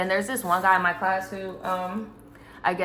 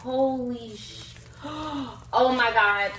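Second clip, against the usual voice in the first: shocked; 4 semitones higher, much slower than usual. Real, not acted. Finds crab broil in bag.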